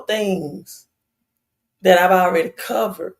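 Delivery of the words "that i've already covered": normal talking voice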